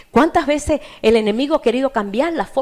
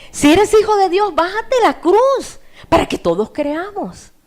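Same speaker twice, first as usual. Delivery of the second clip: jeering crowd shouts; 8 semitones higher, 4 dB louder than usual. Acted. Mockers challenging Jesus on the cross, jeering shouts. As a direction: yell a taunting dare as the crowd. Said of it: "Comenzaron a gritarle".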